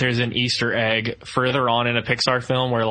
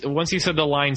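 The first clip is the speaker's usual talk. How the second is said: ordinary talk, nothing marked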